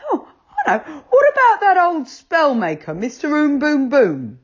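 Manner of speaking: a rabbit struck by an idea; eager rabbit. A narrator's reading.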